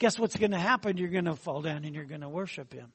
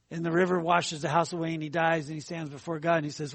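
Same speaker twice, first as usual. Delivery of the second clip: ordinary delivery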